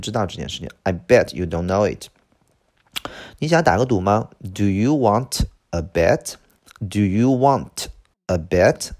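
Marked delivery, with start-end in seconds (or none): 4.5-8.9 slow, separated "Do you want a bet"; slow teaching pace, words unlinked. Shown as a demonstration. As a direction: say each word of "Do you want a bet" apart. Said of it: "连读".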